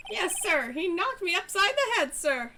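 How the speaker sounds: high, loud eager officer voice; annoying voice. Acted. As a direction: squeak out every sir, overeager rookie. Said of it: "I committed to this voice".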